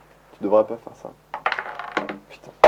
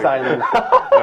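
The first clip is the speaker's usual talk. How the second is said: laughing tease; 2 semitones higher, slower than usual. Pretend. Echoed teasing with laugh.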